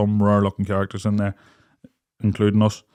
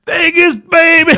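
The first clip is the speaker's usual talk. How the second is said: very high, loud holler; imitating a mate's distant bellow; mock party roar; 9 dB louder, slower than usual. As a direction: whoop it across a crowded casino. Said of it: "All you'd hear from the other side of the room".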